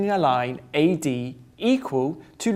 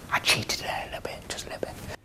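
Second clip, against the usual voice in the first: whispering; 11 dB quieter, much faster than usual. Real, not acted. Confessing aside to camera.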